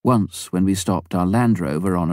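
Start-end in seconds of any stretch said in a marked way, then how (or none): none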